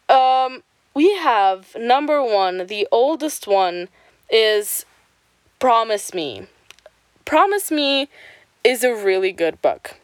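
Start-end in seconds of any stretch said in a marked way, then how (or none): none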